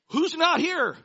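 high, loud commander's demand; urgent demand. Retold or imitated. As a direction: call out to the whole troop. Saul demanding who is missing.

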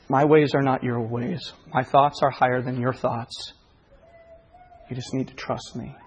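low, hushed voice of God; Spirit speaking. Retold or imitated. The Spirit answering a desperate father, low and hushed. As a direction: speak low and soft, fading to very quiet. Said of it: "the Spirit just spoke".

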